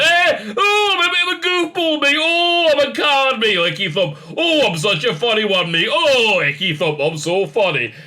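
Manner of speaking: high, loud mocking goofball voice; hammed-up self-styled funny one, starting high and loud. Mocking the actress in goofy goggles, hammed-up goofball. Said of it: "staring up through the goofy goggles going".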